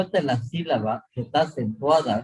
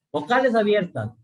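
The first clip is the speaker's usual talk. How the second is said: ordinary talk, nothing marked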